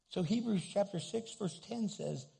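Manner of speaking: usual speaking voice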